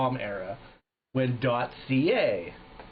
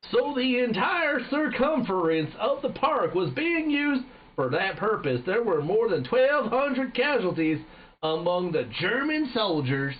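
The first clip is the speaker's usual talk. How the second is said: slow, high-pitched American general drawl; exaggerated American accent; 9 semitones higher, slower than usual. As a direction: stretch it out as a hammy American general. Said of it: "It's a fucking American".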